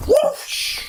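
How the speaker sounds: airy whooshing throw noise; whoosh sound. Retold or imitated. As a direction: blow out a fast whoosh. Imitating axe throw sound.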